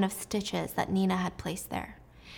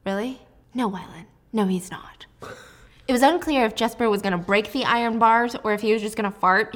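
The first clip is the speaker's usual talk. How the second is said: normal talking voice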